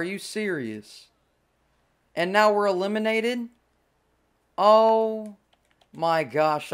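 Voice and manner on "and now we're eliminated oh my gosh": outraged. Real, not acted. Outrage at elimination.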